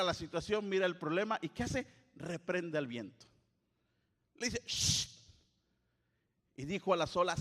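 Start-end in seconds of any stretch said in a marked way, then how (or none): 4.7-5.1 shushing. Acted. Jesus rebukes the wind.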